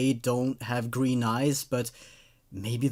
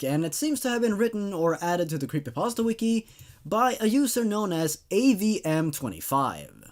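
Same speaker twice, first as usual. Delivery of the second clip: ordinary delivery, nothing marked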